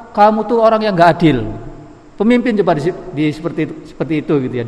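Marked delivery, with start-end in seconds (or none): 0.1-1.4 higher-pitched imitation of an outspoken Bedouin; mildly raised voice, accusing outright; blunt loud accusation. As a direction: imitate a brash villager confronting a leader. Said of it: "ngomongnya keras, kenceng ya".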